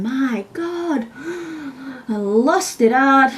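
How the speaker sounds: slow, acted tearful outburst; tearful lament. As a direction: draw it out as if close to tears. Lamenting a lost job.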